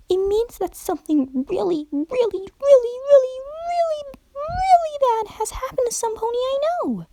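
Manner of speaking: very high, bubbly cartoon pony voice; giddy high pony explaining her twitches; hyper Pinkie Pie. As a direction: chatter it like an excitable party pony.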